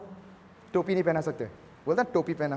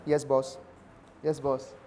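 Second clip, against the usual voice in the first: meek employee; 7 semitones lower, 7 dB quieter than usual. Retold or imitated. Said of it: "office में डांट रहा है".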